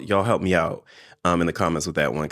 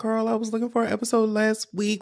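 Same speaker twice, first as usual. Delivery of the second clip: much higher, mock-exasperated fan complaining; whiny impatient fan; 14 semitones higher than usual. Mocking impatient viewers who wanted a new episode, in a high whiny voice. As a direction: play the viewer who's been waiting for an episode, pitched way up and whiny. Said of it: "I know what y'all about to say".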